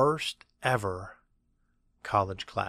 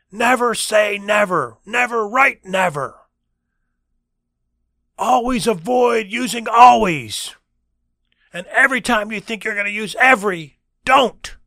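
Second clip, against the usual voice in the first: imitated professor shouting his commandments; passionate professor; 11 semitones higher, 11 dB louder than usual. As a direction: hammer out each rule to the class. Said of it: "He was extremely passionate".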